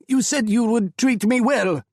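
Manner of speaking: high, loud distressed accusation; upset accusation. A narrator's reading. Hamid confronting the men who promised him safety, loud and upset. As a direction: cry out feeling betrayed.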